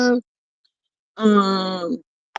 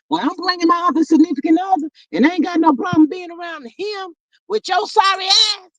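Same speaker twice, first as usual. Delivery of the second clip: higher, faster, defiant mock comeback; defiant retort; 8 semitones higher than usual. Host voicing Mel snapping back at her ex, defiant. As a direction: toss it back fast like you're done with him.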